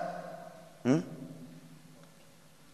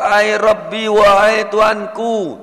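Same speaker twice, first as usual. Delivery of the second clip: pleading; 6 semitones higher, 6 dB louder, much slower than usual. Acted. Man begging God for paradise.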